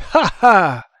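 loud, delirious stage laugh; mad, mocking "ah ah" laugh; burst of laughter. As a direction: burst out in a defiant cackle. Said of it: "diede in uno scroscio di risa".

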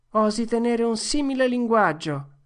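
feed the forgotten words; prompting line. Acted. Tom prompts the book line. (Tom suggerisce la battuta, più forte)

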